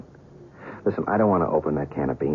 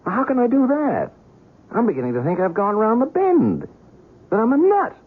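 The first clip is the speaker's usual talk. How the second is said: high-pitched, frantic distress; agitated outburst; 3 dB louder than usual. Acted. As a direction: blurt it out, voice leaping up as if losing your mind. Agitated outburst of self-doubt.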